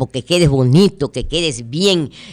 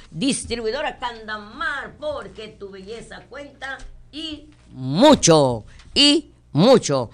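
ad slogan; 4 semitones higher, 4 dB quieter, slower than usual. Acted. Ad slogan sign-off.

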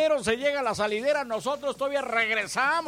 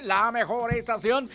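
ordinary talk

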